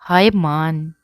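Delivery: put-on a girl gushing over him; affectionate greeting. A narrator's reading. Nadia greeting Maan sweetly. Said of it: "کسی نے پیار سے کہا".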